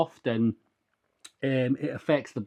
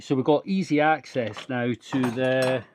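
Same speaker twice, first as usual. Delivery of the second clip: ordinary talk, nothing marked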